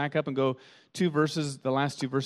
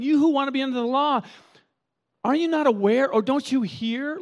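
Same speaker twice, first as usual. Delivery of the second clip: much higher and louder, demanding; incredulous challenge; 9 semitones higher, 5 dB louder than usual. Retold or imitated. Paul challenging those wanting the law, high and loud. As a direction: press them hard on the law. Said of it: "that's what Paul's saying".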